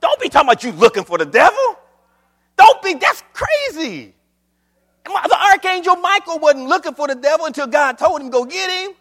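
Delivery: loud, high-pitched pulpit scolding; incredulous preacher, pitched high and driving; full-force preaching rebuke. Acted. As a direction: scold the congregation at full volume.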